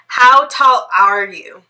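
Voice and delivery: normal talking voice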